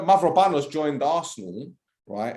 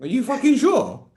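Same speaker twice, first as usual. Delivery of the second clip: high-pitched, indignant mock-mum voice; imitating someone's mum; 10 semitones higher, 3 dB louder than usual. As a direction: put on a scolding mum, pitched well up. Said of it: "imagine his mum talking like that".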